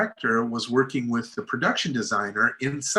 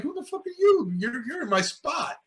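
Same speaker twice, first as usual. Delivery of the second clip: much higher, hammed-up challenge; mock tough guy; 14 semitones higher, 4 dB quieter than usual. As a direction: act out squaring up to a rival, half joking. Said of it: "I'm doing the same thing to him".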